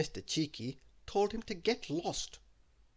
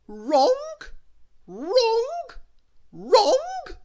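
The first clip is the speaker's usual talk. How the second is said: very high repeated shouts; shrieked, drawn-out bossy scolding; Little Miss Bossy; 12 dB louder, much slower than usual. A narrator's reading. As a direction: shout each word as a separate blast. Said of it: "Little Miss Bossy shouting".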